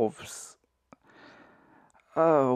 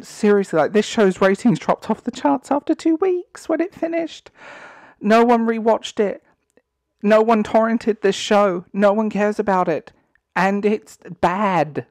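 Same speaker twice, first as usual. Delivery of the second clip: heated rant; 6 semitones higher, 4 dB louder than usual. Real, not acted. Heated rant about ratings.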